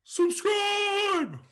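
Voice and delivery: high, loud call to subscribe; shouted plug. Real, not acted. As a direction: yell it out to the viewers. Calling out to viewers.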